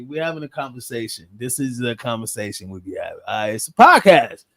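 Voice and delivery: normal talking voice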